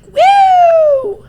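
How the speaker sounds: high loud whoop; excited whoop. Retold or imitated. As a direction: cheer wildly. Soul's baby energy.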